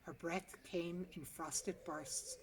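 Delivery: plain reading voice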